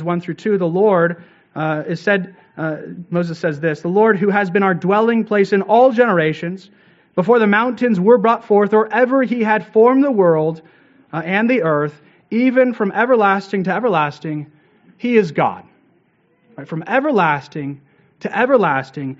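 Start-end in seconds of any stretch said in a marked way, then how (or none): 3.8-15.6 raised, solemn reading of the psalm, a bit louder; scripture reading. Acted. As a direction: proclaim the verses to the congregation. Reads Psalm aloud in sermon.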